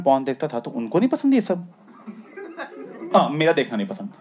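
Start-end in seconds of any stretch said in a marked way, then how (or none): none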